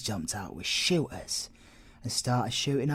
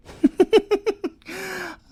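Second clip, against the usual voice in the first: high loud burst of laughter; delighted laugh; 8 dB louder than usual. Acted. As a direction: crack up at his retort. Delighted at the inspector's reply.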